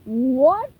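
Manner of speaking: loud indignant squawk; outraged seagull; seagull cry. Acted. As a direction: shout it, rising. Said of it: "they cried".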